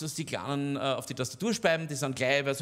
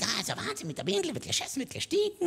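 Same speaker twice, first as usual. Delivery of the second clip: high, fast mocking whine; mock granny whine; 12 semitones higher, faster than usual. Comedian mocks the granny with a high, fast whine. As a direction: parrot her complaint, fast and high. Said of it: "Und ich mein".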